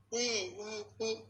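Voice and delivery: soft high mock sobs; imitated crying. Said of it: "Ditanya nangis kenapa diam".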